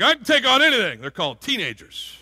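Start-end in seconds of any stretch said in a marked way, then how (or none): 0.0-1.0 higher, louder mock teen bravado; cocky teenager. Teenagers who think they can take on anything, mock cocky. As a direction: puff up like a know-it-all teen. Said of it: "they start feeling like".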